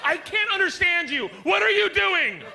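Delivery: shrill-pitched angry shout; mad wife yelling. Acted. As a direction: scold at full volume like a furious wife. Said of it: "She gets mad at you".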